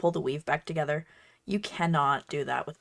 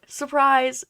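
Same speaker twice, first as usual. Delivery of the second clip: mock surprise; 7 semitones higher, 7 dB louder than usual. Mock lore reveal.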